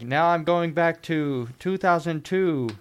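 a hint of a Dutch villain; Goldmember impression. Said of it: "he's like".

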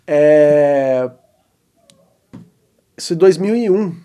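normal talking voice

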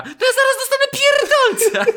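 very high, loud frantic yell; [name]. Retold or imitated. Co-host playing a soundboard clip from Instagram, shrieked. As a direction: squeal it out like you are cracking up. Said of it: "tak o [name] z, ze swojego Instagrama".

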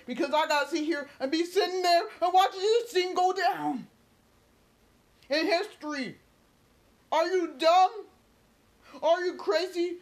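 high, heated outburst; exasperated fan yelling; angry disbelief. Real, not acted. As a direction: fire questions out in real outrage. Angry rant, yelling at the fans.

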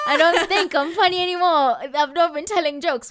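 high, loud, laughing through the words; laughing retelling. Retold or imitated. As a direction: retell your own complaint, cracking up. Laughing while retelling her own complaint.

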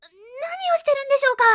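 loud, high shocked cry; startled outburst. Acted. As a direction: blurt it out in alarm. Shocked at what Kaede is doing.

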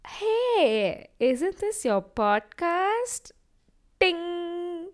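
high, slow, sing-song tease; mock the friend calling him out; teasing friend. Friend teasing him about his podcast in front of others, sing-song.

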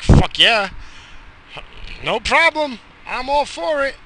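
mock eager. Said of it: "I'm just like".

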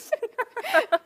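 high bright laugh of delight; burst of laughter. Real, not acted. Host tickled by the guest's Thai reply, laughing high.

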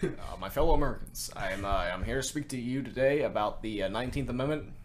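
like a president at the podium; Barack Obama impression. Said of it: "my Barack Obama voice".

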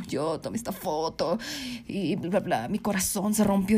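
low, soft mock-wounded boyfriend; dismissive low-voiced imitation. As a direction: mimic his sob story, dropped low and quiet. Mimics the boyfriend's sob story.

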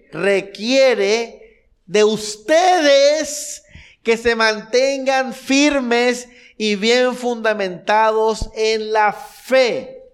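very slow, loud and high, every word hammered; emphatic preaching. Acted. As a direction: preach it out word by word at full force. Preacher stresses verse twenty-three.

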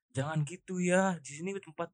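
soft imitation of an admonishing landlord; hushed mock reprimand; gentle retold scolding. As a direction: quote a quiet, restrained telling-off. Voices landlord's mild admonishment.

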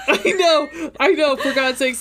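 laughing through the words. Real, not acted. Agreeing with her friend about the book, laughing. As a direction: giggle out the agreement.

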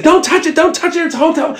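high, frantic mock screeching; imitated yelling. Counter lady still yelling at the helpers, mock screech. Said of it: "the lady's still yelling".

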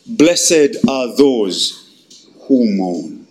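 slow low intoning of scripture; solemn mourning. Acted. Preacher reading the beatitude in slow solemn mourning.

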